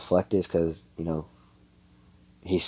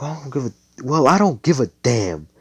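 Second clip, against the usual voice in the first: higher voice, rising to loud; defiant retort; 6 semitones higher, 4 dB louder, faster than usual. Acted. Girl answers the lecture on misfits, defiant retort. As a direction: throw it back with mild anger.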